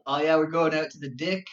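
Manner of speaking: "dick" for deck, E turned to short I; Kiwi vowel shift. Acted. Imitating Kiwis at camp, "dick" with short I for deck. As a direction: say "dick" where deck is meant, Kiwi-style. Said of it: "anything with an E, it was an I".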